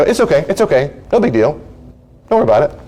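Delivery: high, hurried-to-reassure voice; light mock reassurance; acted brush-off. Acting out brushing off upset.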